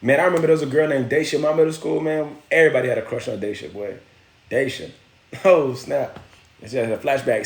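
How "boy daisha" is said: ordinary delivery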